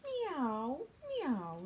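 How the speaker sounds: cat meow. Acted. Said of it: "said Cat".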